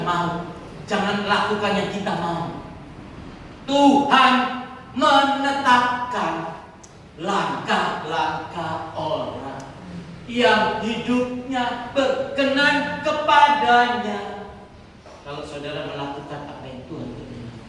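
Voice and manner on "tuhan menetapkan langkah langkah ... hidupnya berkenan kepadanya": very slow, word by word; declaimed verse. Real, not acted. Preacher reciting scripture to the congregation, slow and declamatory. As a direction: stretch each phrase with weighty pauses.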